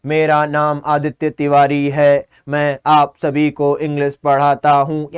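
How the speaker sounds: slow, low monotone demo with every word on one note. Shown as a demonstration. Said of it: "monotone यानी कि".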